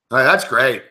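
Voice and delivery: deadpan sarcasm, fed up; sarcastic. Real, not acted. Fan mocking his team's bad play, sarcastic.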